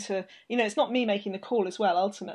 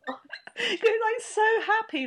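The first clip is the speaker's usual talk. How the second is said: overjoyed; 13 semitones higher, slower than usual. Real, not acted. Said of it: "I'm trying not to cry".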